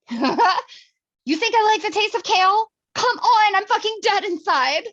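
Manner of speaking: high, loud, worked-up outburst; exasperated movie-quote outburst; mock outburst. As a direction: blurt it out high and loud, drawing out the start. Acting out line from movie notes.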